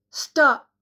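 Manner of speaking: high, loud gasp of a plea. A narrator's reading. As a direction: Anne gasping at Jack to stop, high. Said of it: "she gasped".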